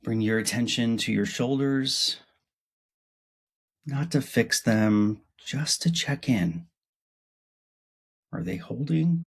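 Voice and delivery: unhurried body-scan voice, spaced phrases; guided meditation. Acted. Host guiding listeners to notice their body, guided meditation. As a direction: lead them through the body, pausing after each part.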